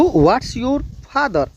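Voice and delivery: very slow, every word spaced out; slow classroom demo; slow model sentence. Shown as a demonstration. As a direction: spell it out slowly like a teacher. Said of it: "ऐसे बोलना है".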